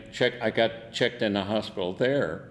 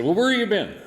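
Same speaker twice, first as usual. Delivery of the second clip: pitched up high and louder, nagging; mock scolding nurse; 12 semitones higher, 7 dB louder than usual. Retold nurse scolding the officers.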